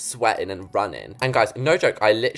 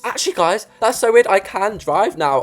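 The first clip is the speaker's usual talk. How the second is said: sudden high realisation; suddenly remembering, amazed; surprised; 9 semitones higher, 7 dB louder than usual. Real, not acted. Realising she can drive.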